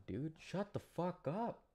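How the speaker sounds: normal talking voice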